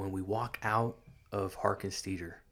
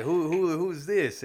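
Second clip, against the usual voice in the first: higher, stammered "who, who, who"; mock startled wife; 8 semitones higher, 3 dB louder than usual. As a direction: play the wife caught off guard, a bit hammed. Wife startled by ex's appearance.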